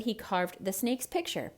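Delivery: usual speaking voice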